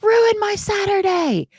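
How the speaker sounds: high, loud and slow mock cheer; a silly high cheer imitating the chat; mock excited fan. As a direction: ham up an eager student's yell. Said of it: "y'all are excited, like".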